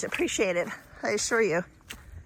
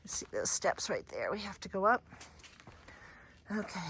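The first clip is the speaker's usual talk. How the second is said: out of breath; 2 semitones lower, 10 dB quieter than usual. Real, not acted. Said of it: "Sorry, it's just hard to talk".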